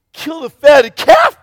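high, loud, slow disbelieving question; can't believe what he's hearing; incredulous anger. Acted. Older son questioning the father's feast, angry and incredulous. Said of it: "just angry".

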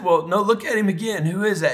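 lightly voiced nurse, pitched high; nurse prompting. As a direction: coax a patient to look harder. Nurse prompting confused dad, voiced.

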